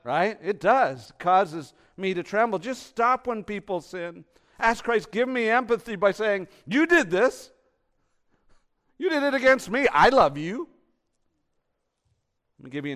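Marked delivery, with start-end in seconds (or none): 0.0-6.5 high, loud, urgent exhortation; full-force preaching. Real, not acted. 6.7-10.7 very loud, high-pitched acted plea; impassioned outcry. Acted impassioned confrontation in prayer.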